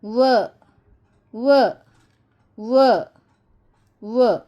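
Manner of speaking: "व" as a short wa for W, not "double-u"; letter sound w. Shown as a demonstration. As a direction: sound out "व" firmly, repeated. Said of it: "लेकिन इसकी आवाज़ है व".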